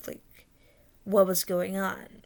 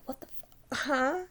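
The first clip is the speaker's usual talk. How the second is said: retold bafflement; 5 semitones higher than usual. Dreamer baffled at being shushed.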